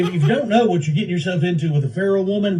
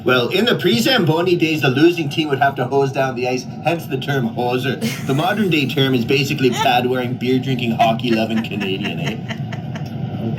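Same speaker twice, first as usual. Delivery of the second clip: Siri voice; 3 semitones lower than usual. Pretend. Siri explains hoser.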